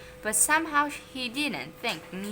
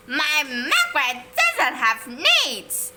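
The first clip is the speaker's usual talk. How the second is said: high, loud, outraged shriek; 6 semitones higher, 7 dB louder than usual. A narrator's reading. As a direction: shriek it like an offended mother. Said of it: "shrieked Moody Margaret's mother".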